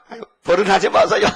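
laughing, high, loud plea; pleading mother. Retold or imitated. Mother pleading with the policeman, laughing and loud. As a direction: beg the officer while half laughing.

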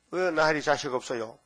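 ordinary talk, nothing marked

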